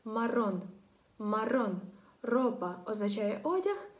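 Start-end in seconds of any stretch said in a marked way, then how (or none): 0.0-1.8 марон with a long trilled double р; strong rolled r. Shown as a demonstration. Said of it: "дві Р стоїть". (марон з сильним розкотистим р)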